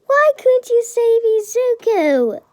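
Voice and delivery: high, loud accusing shout; angry yell. A narrator's reading. Mother Inko confronts All Might, furious yell. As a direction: yell it high, falling hard on the last word. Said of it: "she yells at All Might".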